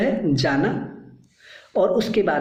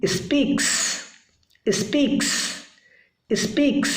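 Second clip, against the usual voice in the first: Speaks with the final s hissed clearly and pitched up; stressing the s of speaks; 8 semitones higher than usual. Shown as a demonstration. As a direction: repeat speaks, leaning on the added s at the end. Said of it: "अब उसके साथ लगेगी city".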